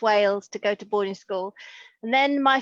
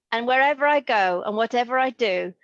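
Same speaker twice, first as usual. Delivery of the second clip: ordinary delivery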